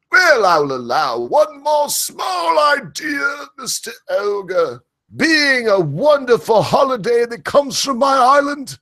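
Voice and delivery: very high, slow, shouted posh British; grandly allow it like a pompous Brit; British accent. Retold or imitated. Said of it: "I can hear the British right now".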